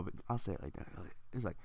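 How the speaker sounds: usual speaking voice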